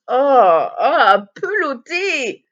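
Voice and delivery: loud, drawn-out shocked exclamation; loud, high, slow repeat of a shocking word; shocked outcry. Real, not acted. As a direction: cry out, stretching the word in disbelief. Shocked at the word just read.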